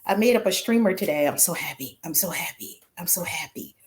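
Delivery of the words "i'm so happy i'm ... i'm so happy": much softer, breathy near-whisper of delight; hushed joy. Real, not acted. Host to viewers about her new streamer, soft whisper. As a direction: hush it like a happy secret.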